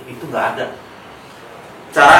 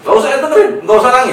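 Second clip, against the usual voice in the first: laughing reassurance; 13 semitones higher, 8 dB louder, faster than usual. Real, not acted. Comforting crying child, laughing.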